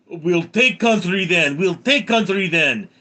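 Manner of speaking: loud, high mock Russian threat; Vladimir Putin. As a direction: hammer it out in a cartoon Russian accent. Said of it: "And Putin goes".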